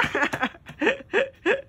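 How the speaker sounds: high-pitched burst of laughter. Real, not acted. Vlogger laughing at the kids' banter. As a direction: let out a squealing laugh.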